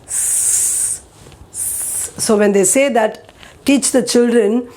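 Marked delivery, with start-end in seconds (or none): 0.0-2.1 unvoiced snake hiss; soft drawn out s hiss. Shown as a demonstration. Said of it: "people telling it like".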